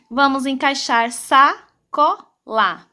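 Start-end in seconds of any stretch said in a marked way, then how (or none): 1.1-2.9 slow, gaps between syllables; sílabas separadas. Shown as a demonstration. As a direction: say sacola as SA, CO, LA. Teacher sounds out word to fit. (leitura silabada e lenta)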